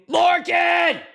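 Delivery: hammed-up movie-scene scream; anguished shout. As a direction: cry out for your fallen brother. Quoting Tombstone's anguished cry.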